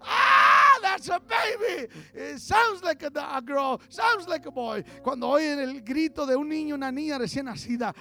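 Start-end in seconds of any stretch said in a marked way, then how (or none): 0.0-4.8 high, loud cry then eager chatter; excited new parent. Retold or imitated. New parents hear their baby cry, excited. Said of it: "Los recién iniciados, padre, madre".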